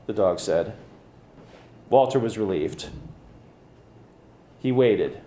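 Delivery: ordinary delivery, nothing marked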